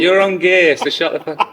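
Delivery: playful yelled insult, easing off at the end; mock shouted retort. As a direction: fire it back in pretend outrage. Joke retort shouted at the coach.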